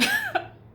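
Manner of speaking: sudden high-pitched laugh; high squeal of laughter. Real, not acted. Laughing to viewers after taunting her enemy. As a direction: let a squealing laugh burst out.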